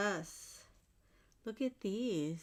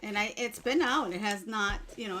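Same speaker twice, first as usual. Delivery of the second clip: ordinary delivery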